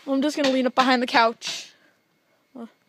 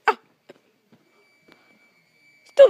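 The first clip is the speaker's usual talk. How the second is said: normal talking voice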